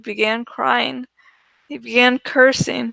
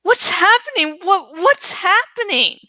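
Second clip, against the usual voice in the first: higher, louder panicked scream; 7 semitones higher, 3 dB louder than usual. A narrator's reading. Frightened student screaming as the teacher collapses, panicked. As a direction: shriek it in terror. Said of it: "began screaming".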